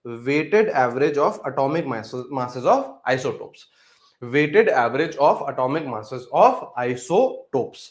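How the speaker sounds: very slow, word by word; slow dictation. Real, not acted. Teacher dictating a definition for students to write down, slow dictation. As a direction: dictate it with long pauses for note-taking. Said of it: "लिखते हुए चलिए बेटा".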